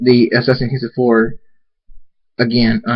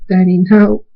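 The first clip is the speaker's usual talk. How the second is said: high, loud plea to a father; distressed, raised little-kid wail; tearful child; 8 semitones higher, 5 dB louder than usual. Acted. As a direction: cry out in grief for daddy. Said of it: "Tears fall from Scooter's eyes".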